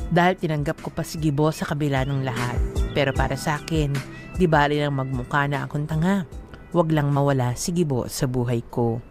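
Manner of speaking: ordinary delivery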